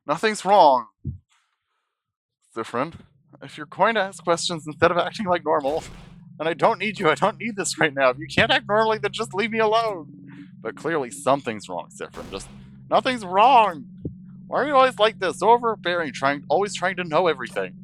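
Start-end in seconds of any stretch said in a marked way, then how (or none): 0.1-10.1 loud, higher, snapping back in anger; angry outburst. Acted. Acted quarrel with Odile. 12.9-17.7 loud and higher, lashing out at a friend; angry shouting. Acted. Acted quarrel with Odile.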